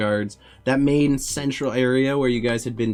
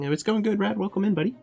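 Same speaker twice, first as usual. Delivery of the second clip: friendly guard; 12 semitones higher, faster than usual. Acted. Guard greeting the party.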